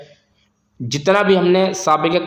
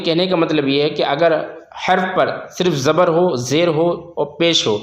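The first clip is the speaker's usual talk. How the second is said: ordinary talk, nothing marked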